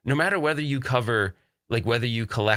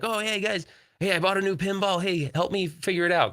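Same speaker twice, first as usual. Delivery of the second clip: higher-pitched pretend greeting to neighbours; excited calling out; 9 semitones higher than usual. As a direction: pipe up as if calling the guys over. Imagined self calling to neighbours.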